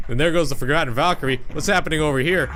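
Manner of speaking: usual speaking voice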